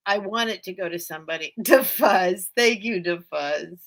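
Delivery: ordinary talk, nothing marked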